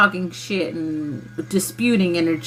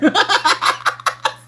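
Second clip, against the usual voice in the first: loud burst of giggling; high cackling laugh; 11 semitones higher, 4 dB louder than usual. Real, not acted. Laughing at their own certainty, high cackle. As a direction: crack up, high and breathless.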